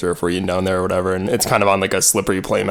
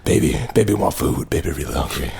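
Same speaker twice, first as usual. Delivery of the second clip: put-on sixty-year-old smoker voice. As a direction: play a sultry aging smoker. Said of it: "sixty-year-old smoker".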